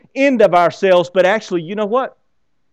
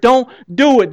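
louder, raised warning; pride shouting; 6 semitones higher, 5 dB louder than usual. Pretend. Inner pride warning the believer, raised voice. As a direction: urge them back with a push. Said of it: "your pride is screaming".

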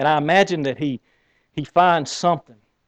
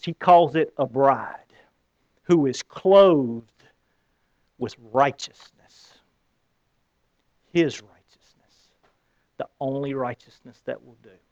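slow solemn; 3 semitones lower, slower than usual. Real, not acted. Slow solemn sermon close.

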